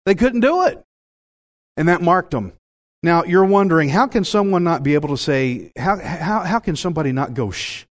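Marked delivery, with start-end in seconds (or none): none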